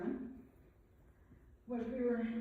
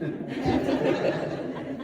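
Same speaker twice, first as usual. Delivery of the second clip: much louder burst of laughter; 4 semitones higher, 12 dB louder than usual. Real, not acted. Daughter laughs about sister-in-law's mistake, loud laugh. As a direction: break into a real laugh.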